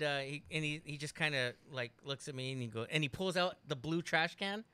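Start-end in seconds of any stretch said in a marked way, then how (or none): none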